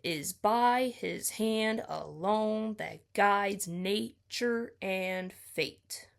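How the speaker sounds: slow, weighted read of the line with the trochee up front; scansion reading. Shown as a demonstration. Reading line fourteen aloud to show its meter, slowly. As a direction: read it out stressing "Is" first. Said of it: "So I read fourteen as".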